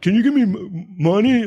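high mock begging voice; begging man. Homeless dude coming up to him, high imitated beggar voice. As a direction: imitate a panhandler, pitched well up. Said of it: "one come up to me and he's like".

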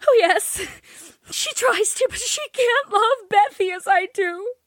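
put-on high, crying girl's voice, breaking; despairing sobs. A narrator's reading. Joe breaking down to Laurie over Beth, sobbing. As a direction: sob it out in despair. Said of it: "she cried despairingly".

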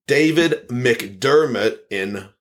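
ordinary talk, nothing marked

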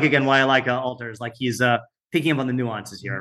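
normal talking voice

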